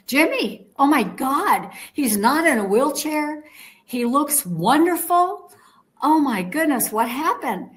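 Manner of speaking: high, eager retold exclamation; excited exclaiming. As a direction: gush over how wonderful he looks. Exclaiming Jimmy isn't in wheelchair.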